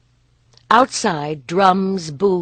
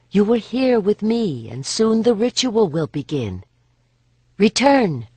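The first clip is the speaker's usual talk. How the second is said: higher, louder summoning call; calling her back; 6 semitones higher, 5 dB louder than usual. Acted. Lianen calls her back.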